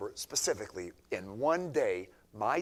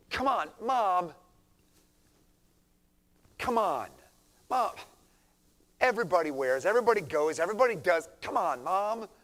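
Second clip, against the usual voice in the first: loud, high whining plea; pleading teenager, loud and high; whiny teen begging; 7 semitones higher, 8 dB louder than usual. Acted. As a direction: nag mom like a spoiled teen. Whiny kid begging mom.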